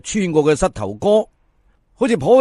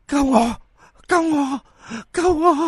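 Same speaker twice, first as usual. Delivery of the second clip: each cry climbs higher, thin and haunting; a drowned corpse begging, rising higher every time; eerie ghostly plea; 10 semitones higher, slower than usual. Acted. Corpse begging Fan Saam-long to save him, eerie rising plea.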